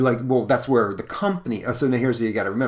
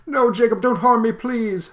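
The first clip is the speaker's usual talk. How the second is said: much higher, louder pleading squeal; cowering old miser; 13 semitones higher, 6 dB louder than usual. Acted. Old miser begs his ghostly partner, high frightened squeal.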